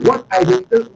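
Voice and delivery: high, put-on voice for a quoted speaker; playful high impression of a caller; acted quote. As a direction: pitch way up to play the other person. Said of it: "You say".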